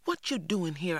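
high-pitched, tense hiss at an intruder; hushed-up scolding, pitched high; hissed challenge. A narrator's reading. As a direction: hiss it at someone sneaking about. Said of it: "I hissed".

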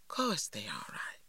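whispered, soft and low; hushed reassurance. A narrator's reading. Mother whispers comfort to her frightened daughter, hushed. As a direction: hush the child's fear in a whisper.

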